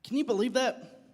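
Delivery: ordinary talk, nothing marked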